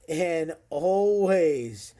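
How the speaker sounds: drawn-out, slow catchphrase; slow sign-off. Real, not acted. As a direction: stretch out the sign-off. Signature sign-off.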